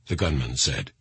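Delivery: ordinary delivery, nothing marked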